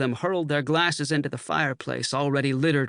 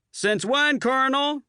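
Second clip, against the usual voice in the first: put-on young lieutenant shouting over laughter; shouted quip; 12 semitones higher than usual. A narrator's reading. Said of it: "shouted over the laughter".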